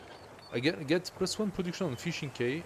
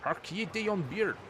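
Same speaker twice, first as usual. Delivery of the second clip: higher, slow hammed-up pirate English accent; 7 semitones higher, slower than usual. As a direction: hail him like a stage pirate in English accent. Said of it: "the pirate, uh, English accent".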